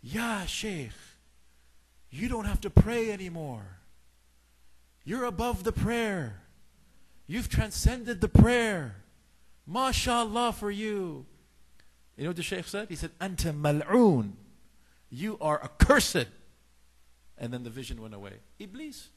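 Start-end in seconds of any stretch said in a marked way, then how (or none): 0.0-11.3 high, slow, drawn-out celestial proclamation; a booming voice from above, stretched out slowly; soothing heavenly voice. Retold or imitated. False heavenly voice tempting the Shaykh, grand and soothing. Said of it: "And a soothing voice said". 13.2-16.3 loud, drawn-out curse; slow stern rebuke. Retold or imitated. Shaykh answering the vision of Iblis, loud stern rebuke.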